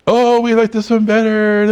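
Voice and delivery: imitating the smug owners; sing-song impression of the sellers; mock proud homeowners.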